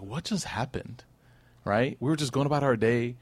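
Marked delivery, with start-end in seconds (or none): 0.0-1.0 soft, slow bewildered murmur; stunned hush. Retold or imitated. Stunned Meccans after the warning, soft and slow. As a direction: barely breathe it out in shock. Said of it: "everyone is stunned in silence".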